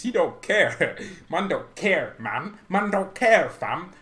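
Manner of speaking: London slang, punched and laughing; chuckling mock London swagger; mock London accent. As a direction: imitate a London footballer, leaning on each phrase. Mimicking the London-accented player again.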